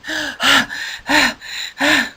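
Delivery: long, soft worn-out sigh; a tired hippo flopping home; exhausted sigh. Acted. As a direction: huff out all your breath. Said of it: "he sounded like this".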